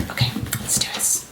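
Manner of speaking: soft whisper; whispered resolve. Real, not acted. Vlogger psyching herself up to unbox, whispering. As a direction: whisper it to yourself.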